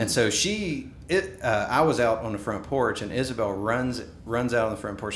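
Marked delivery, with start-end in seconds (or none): none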